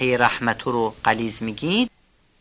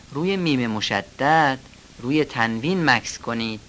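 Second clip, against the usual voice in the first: usual speaking voice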